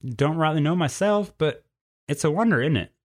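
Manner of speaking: a lightly voiced country cougar explaining; folksy cougar lady. A narrator's reading. Tilly answering the boy's dragon question, folksy and easy.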